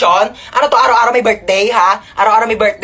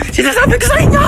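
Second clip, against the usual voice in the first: possessed cackle and shout; 12 semitones higher, faster than usual. Acted. Possessed character ranting.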